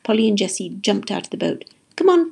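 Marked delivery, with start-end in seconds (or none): 1.9-2.3 high, loud, urging call; yelling. A narrator's reading. Pete urging friends off the boat, yelling. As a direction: holler to hurry them up. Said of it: "Pete yelled".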